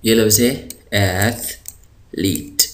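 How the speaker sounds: एथलीट drawn out very slowly; slow model word. Shown as a demonstration. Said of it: "टीएच, इसकी ये जो आवाज़ है".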